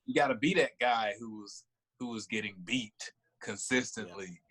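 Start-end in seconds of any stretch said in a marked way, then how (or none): none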